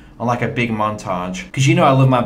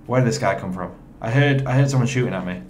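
usual speaking voice

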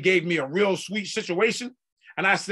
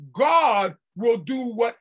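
slow, loud drawn-out call; preaching shout; 3 semitones higher, 5 dB louder, much slower than usual. Acted. Preacher to congregation, slow loud shout. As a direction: stretch it out and shout it.

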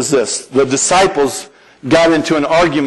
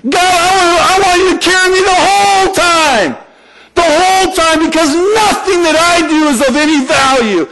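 mock desperate prayer, pitched up; pleading prayer; 10 semitones higher than usual. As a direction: beg God to carry you. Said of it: "Here's what I say".

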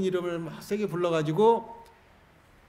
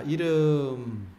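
very drawn-out, louder prompt; loud cue; 6 dB louder, much slower than usual. Shown as a demonstration. Monk cueing the room to shout names, loud drawn-out call. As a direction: hold the word long to launch the crowd. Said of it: "큰 소리로 목청껏".

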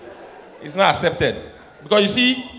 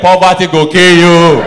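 slow loud Nigerian Pidgin; loud pidgin retort; 12 dB louder than usual. Retold or imitated. Nigerian girl rejecting cheap romance, slow loud pidgin. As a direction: declare it.

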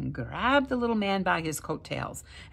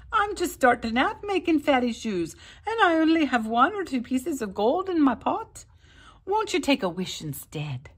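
high, louder wheedling little man; pleading leprechaun; 9 semitones higher, 5 dB louder than usual. A narrator's reading. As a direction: coax him into a wish. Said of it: "he pleaded".

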